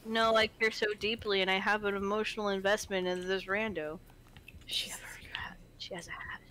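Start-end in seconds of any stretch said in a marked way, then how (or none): 4.7-6.4 hushed whisper, no voice. Real, not acted. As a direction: breathe the words in a whisper. Whispers about a game character.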